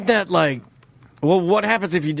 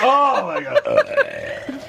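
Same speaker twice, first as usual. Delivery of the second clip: slow, laughing it out; breaking up laughing; 7 semitones higher, much slower than usual. Real, not acted. Laughing at chug mess.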